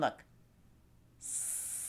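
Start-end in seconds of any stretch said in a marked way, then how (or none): 1.2-1.8 a long unvoiced S hiss with a smile; S held like a snake. Shown as a demonstration. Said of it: "try to make my S sound".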